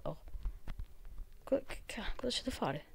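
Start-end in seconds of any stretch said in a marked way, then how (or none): 1.4-2.8 hushed, puzzled whisper; whispered confusion. Real, not acted. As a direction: breathe the question quietly.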